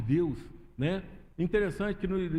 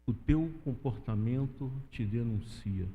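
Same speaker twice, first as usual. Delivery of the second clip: "O teu comportamento te denuncia" slowed right down, lower, each word weighed; slow emphatic repeat; 7 semitones lower than usual. Shown as a demonstration. Preacher repeating the woman's words to the church, slow and weighty. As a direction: let "teu" land heavy and drag the line out.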